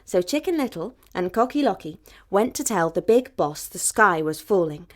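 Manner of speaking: ordinary delivery, nothing marked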